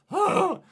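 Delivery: sharp high surprised gasp; real gasp. Real, not acted. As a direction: gasp loudly at the lap time. Surprised by a rival's lap time.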